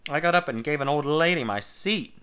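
ordinary delivery

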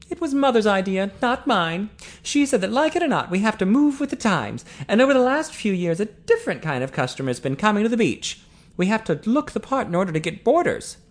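high-pitched woman explaining herself; character voice pitched well above narration, justifying; defensive Eileen. A narrator's reading. Eileen explaining the renovations to accusing Ted, defensive.

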